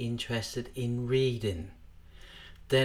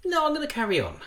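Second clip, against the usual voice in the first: put-on high-pitched child's refusal; defiant child; 3 dB louder, much faster than usual. Child's brain answering the parent, high and defiant. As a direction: voice a kid brushing off the parent, pitched way up. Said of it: "the child's brain basically goes".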